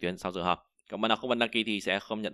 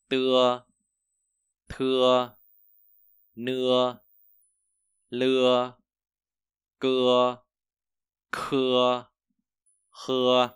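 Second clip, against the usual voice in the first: loud, slow drill of Chinese initials with long pauses between syllables; loud, spaced-out pronunciation drill; louder drill read-out; 3 semitones higher, 7 dB louder, much slower than usual. Shown as a demonstration. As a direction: call each syllable out loud for the class to repeat. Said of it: "Rồi chúng ta đọc lại nha".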